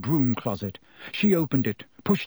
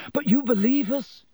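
1 pleading; 11 semitones higher than usual. A narrator's reading. Hermione pleads to Dumbledore.

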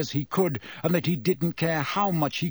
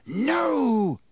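hissed; 9 semitones higher, 3 dB louder than usual. A narrator's reading. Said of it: "he hissed".

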